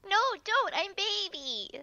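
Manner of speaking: high, cutesy mock plea; small, high pretend-helpless voice; pleading baby. Playfully begs off a fight.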